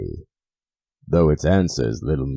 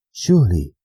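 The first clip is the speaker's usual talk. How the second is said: raised, higher, louder; urgent exclamation; 5 semitones higher, 6 dB louder than usual. Acted. The narrator startled by tapping, calls out loudly. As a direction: call it out sharply. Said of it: "said I".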